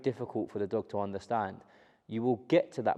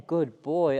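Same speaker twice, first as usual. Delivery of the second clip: high, loud encouraging praise for a dog; 6 semitones higher, 7 dB louder than usual. Real, not acted. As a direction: cheer the dog on, higher and louder. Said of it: "that nice tone of voice".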